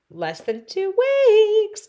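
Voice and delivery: high, loud and stretched out; dismayed. Real, not acted. Told of a two-week wait, high stretched dismay. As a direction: wail it in disbelief.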